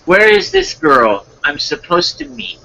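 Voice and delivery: high-pitched wondering read; lightly voiced story character. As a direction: lift the voice high as if looking around.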